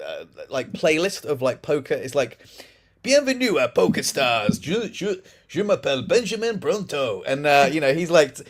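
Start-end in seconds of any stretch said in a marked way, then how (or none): 3.0-7.2 over-articulated French presenter voice; Benjamin Bronto. Retold or imitated. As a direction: imitate a French presenter, every word sung out clearly. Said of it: "he speaks like that".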